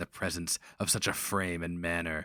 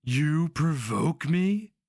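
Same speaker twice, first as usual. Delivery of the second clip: slow, high, louder outraged spit; spat; 9 semitones higher, 6 dB louder, slower than usual. A narrator's reading. Grand Master spits back at the barbarian's taunt, outraged. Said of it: "the Grand Master of the Scarlet Spire spat".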